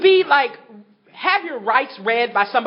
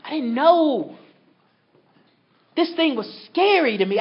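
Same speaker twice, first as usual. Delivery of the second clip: ordinary delivery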